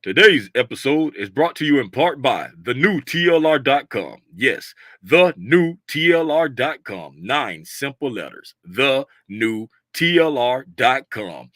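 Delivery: deep, slow radio ad read; low announcer voice selling the shop; sponsor voice. Acted. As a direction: read it like a smooth sponsor spot. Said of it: "Let me go into my sponsor voice".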